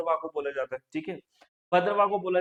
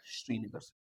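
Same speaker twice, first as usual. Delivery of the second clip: a low, soft student reply from the back; class answering; 9 semitones lower, 14 dB quieter than usual. Pretend. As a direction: answer quietly like the whole class. (धीमी आवाज़ में छात्रों का जवाब)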